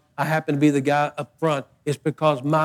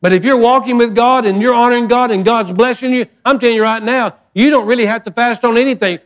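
raised, driving preacher voice; preaching at full force; 5 semitones higher, 4 dB louder than usual. Acted. Pastor urging the congregation, raised and driving. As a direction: preach it out loud and high.

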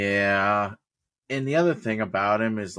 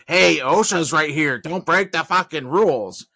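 loud, raised-pitch mock yell; drawn-out yelled reprimand; retold yelling; 6 semitones higher, 6 dB louder than usual. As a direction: yell a warning up to a coworker. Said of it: "explain to you by yelling".

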